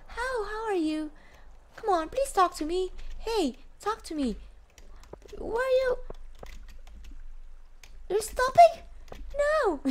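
very high, wheedling plea; mock-desperate, high-pitched coaxing; playful pleading. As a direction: beg for attention in a squeaky-high voice. Begging game character Elliot to talk.

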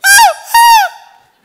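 high-pitched loud howls; imitated dog noise. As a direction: howl like a dog that won't attack. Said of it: "That's what they call it, bingo".

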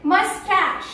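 mus-TASH for "मस्टैच"; correct pronunciation. Shown as a demonstration. Trainer correcting learners, correct pronunciation. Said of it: "इसे हम लोग बोलते हैं".

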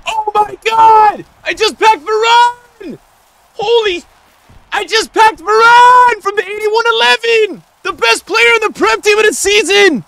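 high, sustained, full-volume shouts; ecstatic screaming. Real, not acted. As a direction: scream the news in disbelief. Celebrates packing Varane.

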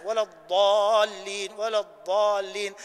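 slow "الضالين" with the tongue up at the teeth. Shown as a demonstration. Teacher demonstrates tongue placement for the dad. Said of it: "اللسان يكون في أعلى".

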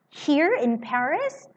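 high, slow astonished question; shocked disbelief. A narrator's reading. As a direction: drag it out in disbelief. Lorry shocked.

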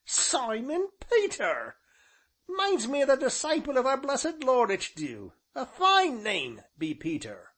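high, loud rustic ancient; high-pitched village elder, delighted by the names; marvelling old man. A narrator's reading. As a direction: proclaim it in a high old countryman's voice. Voices the ancient.

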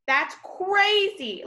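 high, slow astonished exclaim; amazed. Real, not acted. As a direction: stretch it out high in disbelief. Amazed at hummingbird weight.

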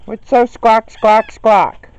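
loud, high squawking parrot imitation; mock parrot call; parrot squawk mimic. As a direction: squawk the words back like the bird.

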